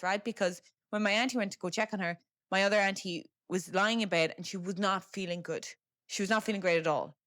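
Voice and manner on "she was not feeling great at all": ordinary talk, nothing marked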